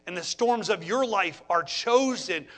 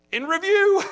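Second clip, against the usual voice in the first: mock announcement; 10 semitones higher, 5 dB louder than usual. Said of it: "I said".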